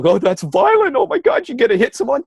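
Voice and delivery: very high, squeaky mock outrage; hammed-up falsetto pearl-clutching; mock shocked objector. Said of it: "people today would go".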